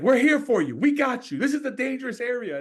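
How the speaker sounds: high, loud imitated officer; calm him while warning of danger; reassuring police.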